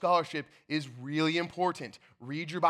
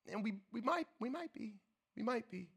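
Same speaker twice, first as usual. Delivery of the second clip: soft concession; 5 semitones higher, 10 dB quieter than usual. Real, not acted. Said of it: "softly conceding".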